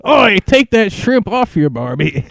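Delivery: high British-turned-Australian yell; hammed-up, badly faked Aussie; mock Australian accent. Podcaster's British bit slides into loud mock Australian. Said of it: "Just Australian now".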